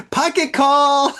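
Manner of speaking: high, drawn-out joke call; mock exclamation. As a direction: sing out the punchline high and slowly. Joking shout after laughing.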